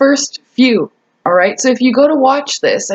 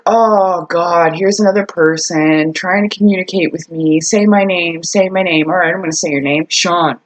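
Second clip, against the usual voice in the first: low, bored, put-upon ghost voice; annoyed ghost; 5 semitones lower than usual. Retold or imitated. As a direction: sigh it out like a fed-up spirit. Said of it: "Maybe it's like".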